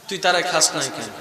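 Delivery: low, soft question to the soil; hushed question. Acted. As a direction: ask it softly, almost to yourself. Quiet repeat of question.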